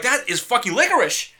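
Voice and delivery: high, loud indignant exclamation; retold outburst. Man retelling his reaction to the waiter, indignant outburst. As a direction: blurt it out in mock outrage.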